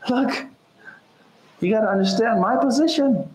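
wife coaxing the city women to see her side; pleading wife. Retold or imitated. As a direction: beg the girls to understand, pitched high.

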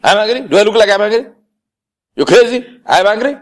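loud, high-pitched indignant outburst; angry denial. Acted. Person insisting they are not angry, indignant shouting. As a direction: protest hotly, voice shooting up in mock outrage. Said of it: "your tone, your face, your acts, everything".

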